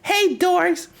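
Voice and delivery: put-on high, sing-song kid taunt tossed down from above; mock a giggly little kid calling names. Little kid taunting tourists from upstairs, high sing-song. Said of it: "now you know the lore".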